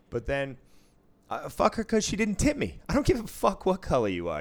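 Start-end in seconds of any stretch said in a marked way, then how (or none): 1.5-4.4 higher and louder, exasperated; heated, pitched-up outburst; indignant rant. Real, not acted. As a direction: vent about the stingy player. Heated retelling of bad tipper.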